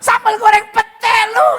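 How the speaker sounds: loud, high, yelled food insult; hammed-up furious shout; mock angry Batak curse. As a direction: yell it like a curse. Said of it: "gitu ya kan".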